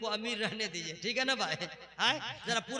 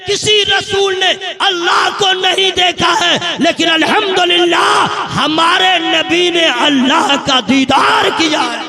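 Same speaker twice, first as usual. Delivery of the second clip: slow, much louder, ringing sermon voice; preaching at full force; 7 semitones higher, 19 dB louder than usual. Acted. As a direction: thunder each line to the crowd. (पूरे जोश में तक़रीर)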